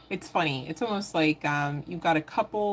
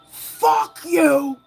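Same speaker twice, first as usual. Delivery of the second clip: much higher, much louder mock yell; retold yelling; 10 semitones higher, 11 dB louder than usual. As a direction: shout it at the last customer. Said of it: "And then he goes".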